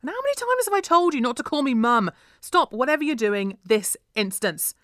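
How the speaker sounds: loud, high-pitched telling-off; angry scolding. A narrator's reading. Mum ordering noisy daughter to stop, angrily scolding. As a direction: scold her at full volume.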